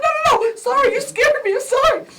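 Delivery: panicked pleading. Retold or imitated. Mother to armed officer, panicked. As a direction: plead fast with a gun pointed at you. Gun drawn on her, panicked.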